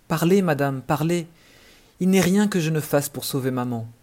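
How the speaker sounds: high, loud, urgent begging; eager plea. Acted. Boy urgently begging the fairy to reveal the cure, loud plea.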